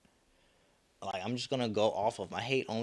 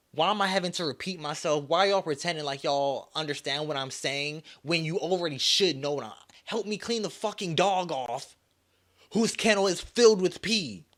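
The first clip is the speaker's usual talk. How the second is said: rising, louder angry rant, pitch climbing; pissed off; 6 semitones higher, 6 dB louder than usual. Real, not acted. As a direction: vent the frustration, building to a loud complaint. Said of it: "So now I'm pissed off".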